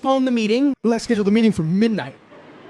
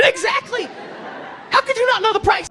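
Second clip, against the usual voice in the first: exasperated; 13 semitones higher than usual. Retold or imitated. Comedian scolding his friend over the price. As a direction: throw your voice up in disbelief.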